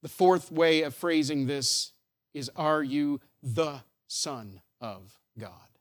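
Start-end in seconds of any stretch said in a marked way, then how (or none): 2.6-5.7 word by word, with pauses; slow, low and deliberate, each word set apart; slow, weighty question. Acted. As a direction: let every word of the question land separately.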